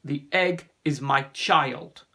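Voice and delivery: slow, loud, weighty declaration; proud and firm. Acted. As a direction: state it firmly, leaving no room for argument. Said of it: "Ossian said proudly and firmly".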